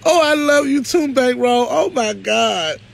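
high, gushing burst of affection; light up for a dear friend, pitch leaping; delighted greeting. Real, not acted. Host spots Bankroll in the chat, delighted and gushing.

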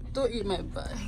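usual speaking voice

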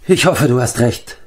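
mildly sighed, doubtful hope; sighing. A narrator's reading. Bard doubts his friend is right, sighing mildly. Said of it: "seufzte der Barde".

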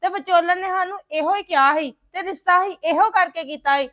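loud, indignant retort; forceful, aggrieved accusation at full voice; angry argument. Acted. Son argues with in-laws over dowry.

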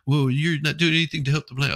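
mock activist. Imitating the activist scolding him, high and whiny. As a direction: whine the accusation at him. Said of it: "I was like".

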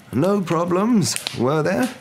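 high, slow, drawn-out questioning; Dumbledore. A narrator's reading. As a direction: ask it slowly, pitched well up.

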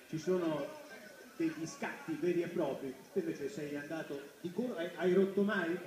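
ordinary talk, nothing marked

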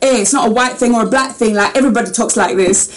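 mock London street accent, almost rapping; badly faked London accent; Multicultural London English. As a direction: imitate London slang talk as if about to rap. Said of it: "sounds almost like they're about to go into".

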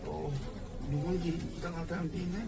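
scared murmur. Real, not acted. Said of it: "무서워".

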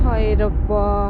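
slow, low groaning sigh of a cry; weary lament. Acted. Wife alone complaining about her sister-in-law, weary slow lament. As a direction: drag it out, worn down.

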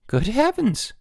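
high-pitched, startled woman; startled cry. A narrator's reading. Woman greets muddy boys at her door, startled cry. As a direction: cry out in shock, pitch leaping up. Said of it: "she cried".

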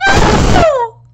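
high, loud wailed "no"; anguished scream. Real, not acted. Reader watching a character die, anguished scream. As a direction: scream out in grief.

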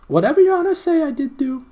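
very high, loud creature voice; squealing gnome. A narrator's reading. Gnome answers his captors, squealing.

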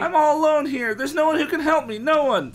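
high, loud panicked wail; high hammed-up kid wail; [name] wailing.

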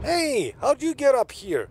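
high-pitched game character voice; put-on high voice. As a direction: pitch it way up, calling out. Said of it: "I could voice this Italian".

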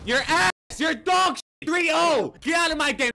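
high, loud taunting yells; loud trash talk. Real, not acted. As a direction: shout down the loser gleefully. Trash talk after eliminating kid.

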